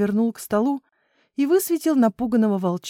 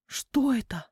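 voiceless, wondering whisper; 3 semitones higher, 4 dB quieter than usual. A narrator's reading. Children listening for a noise at midnight, whisper. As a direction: whisper the question into the hush.

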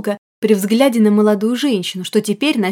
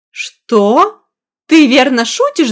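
louder, higher stunned disbelief; shocked; 4 semitones higher, 7 dB louder, much slower than usual. Acted. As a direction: gasp it out in shock. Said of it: "Потрясённая заявлением мужчины".

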